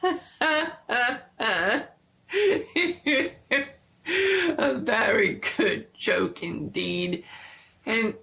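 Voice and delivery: a trapped man forcing a merry, giddy tone; sad voice. A narrator's reading. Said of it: "a sad voice".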